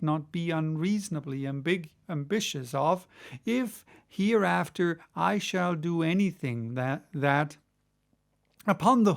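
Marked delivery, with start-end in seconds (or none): none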